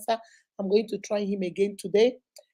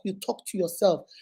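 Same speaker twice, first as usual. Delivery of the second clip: usual speaking voice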